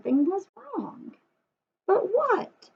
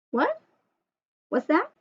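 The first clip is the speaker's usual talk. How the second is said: mock surprise; 5 semitones higher than usual. Pretend listening to a puppet.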